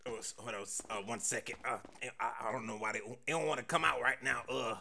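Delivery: low, soft, halting confession; hesitant mumbling with long gaps and ums; nervous stuttering. Acted. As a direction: stumble and stall, words won't come out. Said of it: "Why was I stuttering?".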